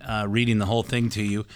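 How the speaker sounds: usual speaking voice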